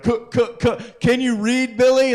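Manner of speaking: high, loud schoolyard taunt; mock the boy who can't read; taunting kids. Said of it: "all the other kids would be like".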